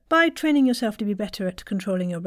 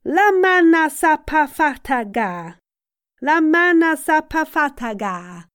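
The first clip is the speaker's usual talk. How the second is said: vocal warm-up; 7 semitones higher, 6 dB louder than usual. Shown as a demonstration. Coach leading a warm-up, high sustained chant. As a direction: project the syllables high and long.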